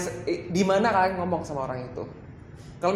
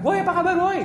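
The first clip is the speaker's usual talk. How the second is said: high, loud yelled hello; shouted greeting; 13 semitones higher, 5 dB louder, faster than usual. Shown as a demonstration. Trainer showing rude greeting in a small place, shouted greeting. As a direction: yell it as if the small room were huge. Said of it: "kecil tapi teriak".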